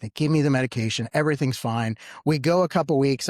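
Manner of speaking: normal talking voice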